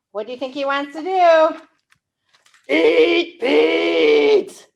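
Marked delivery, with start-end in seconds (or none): 2.7-4.7 low, loud monster growl. Acted. Storyteller growling the monster's wish to eat Pete. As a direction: say it like a hungry beast.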